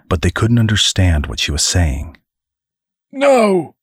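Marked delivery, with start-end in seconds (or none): 3.1-3.8 high-pitched, heated refusal; angry retort. A narrator's reading. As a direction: throw it back angrily, pitched high. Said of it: "came the man's retort".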